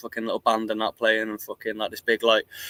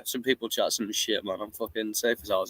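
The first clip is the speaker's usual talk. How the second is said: usual speaking voice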